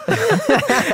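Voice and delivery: sudden high laugh; high burst of laughter. Real, not acted. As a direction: laugh out loud at his outrage. Laughs after his indignant question. (hoher Lacher)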